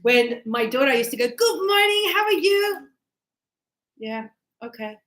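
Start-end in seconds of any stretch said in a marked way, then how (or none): 1.3-2.9 high, singsong mock cheer; super-cheery morning greeting. Imitates own overly cheery morning greeting. 4.0-5.0 soft, subdued reply after mock cheer; deflated mood drop. Mood drops after cheery greeting.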